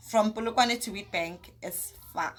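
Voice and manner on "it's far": lower, softer moan about the distance; dropping lament. Real, not acted. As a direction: let it sink, low and soft. Dramatic lament.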